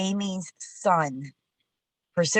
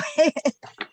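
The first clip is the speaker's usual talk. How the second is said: high, brief chuckle; light laugh tacked on after a remark; short laugh; 11 semitones higher, 4 dB louder than usual. Real, not acted. Laughs after wishing for human studies.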